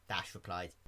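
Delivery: ordinary delivery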